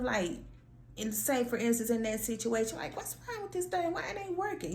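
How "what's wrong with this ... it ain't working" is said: high, thinking out loud; talking to yourself. Shown as a demonstration. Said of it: "you just speaking out loud".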